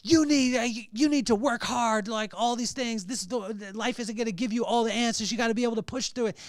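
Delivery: loud, high, stern fatherly yell; old school dad yelling. Retold or imitated. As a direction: chew your son out like an old-school dad. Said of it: "I turned into old school dad".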